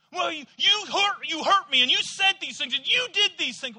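loud, high wounded shout; hurt accusation. Retold or imitated. Wounded person yelling at the one who apologized, high and loud.

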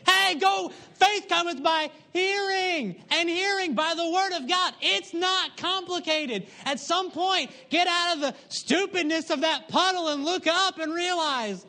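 full-force preaching. Acted. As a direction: preach it like a revival.

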